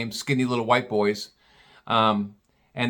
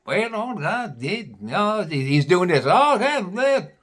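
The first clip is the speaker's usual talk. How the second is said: much higher, muttered hillbilly talk to himself; mumbling bumpkin; 7 semitones higher, slower than usual. Retold or imitated. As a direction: grumble over the tire like a backwoods mechanic. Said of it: "he's talking to himself".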